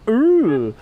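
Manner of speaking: high loud cartoon ooh; collar-pull cartoon ooh; cartoon nervous ooh.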